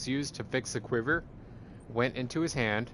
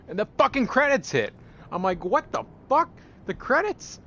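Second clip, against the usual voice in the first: much higher and louder, yelling in disbelief; outraged outburst; 14 semitones higher, 5 dB louder than usual. Real, not acted. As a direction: explode at the credits like you can't believe it. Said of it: "I was so pissed".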